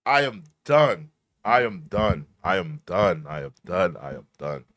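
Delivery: echoed I'm done trailing off; fading echo. Shown as a demonstration. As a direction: fade each repeat away. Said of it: "repeating, fading out".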